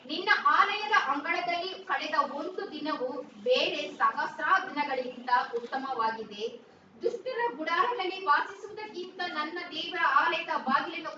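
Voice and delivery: loud, measured reading of a psalm; scripture reading. Real, not acted. Interpreter reads the psalm verse to the congregation, loud scripture reading. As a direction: proclaim the verse loudly and deliberately.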